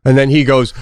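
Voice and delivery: ordinary delivery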